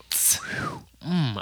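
breathy failed whistle; airy whistle attempt. Real, not acted. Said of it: "I tried to whistle".

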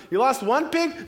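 high, disbelieving query; incredulous question. Acted. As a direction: question it as if mishearing. Townsperson questions the herders.

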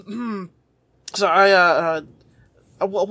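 usual speaking voice

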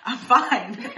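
giggling reassurance; laughing I'm fine. Retold or imitated. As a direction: say it through laughter. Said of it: "I'll be like".

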